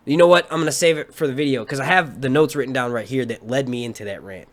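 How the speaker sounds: usual speaking voice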